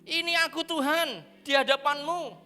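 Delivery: a mild plea to God in Moses' voice; lightly voiced Moses begging off; Moses pleading. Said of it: "Musa mengatakan begitu".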